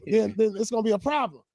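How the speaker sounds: high-pitched retelling of fans' talk; high, animated quote of everybody; imitating the doubters. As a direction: pitch it way up as the skeptical crowd. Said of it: "everybody was like".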